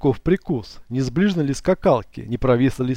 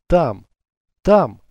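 frightened, rising to a cry; terrified stammer; 3 semitones higher, 5 dB louder than usual. A narrator's reading. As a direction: blurt it out in panic. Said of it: "бормотала Иванка с ужасом". (в ужасе, срываясь на крик)

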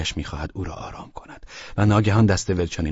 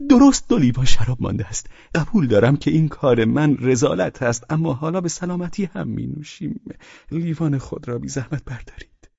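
higher, animated character voice; eager drunken host; 8 semitones higher, 4 dB louder than usual. Acted. Drunkard proposes a toast with the last wine, eager.

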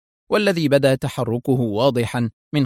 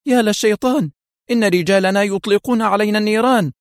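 much higher, horrified exclamation; panicked cry; 10 semitones higher, 3 dB louder than usual. A narrator's reading. Mafia boss under fire in helicopter, panicked. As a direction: cry out in terror, pitch leaping up. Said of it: "بفزع شديد".

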